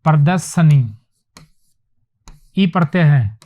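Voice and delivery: ordinary talk, nothing marked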